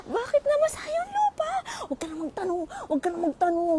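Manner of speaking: high hammed-up whimper; mock whine. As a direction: whimper dramatically for laughs. Mock whiny wail.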